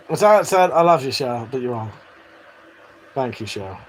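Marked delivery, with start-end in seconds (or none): none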